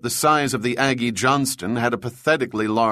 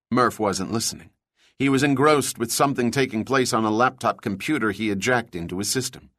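ordinary delivery, nothing marked

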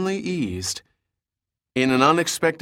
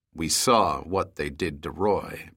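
cold; 7 semitones lower, 4 dB quieter than usual. A narrator's reading. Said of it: "with a cold eye to Tatiana".

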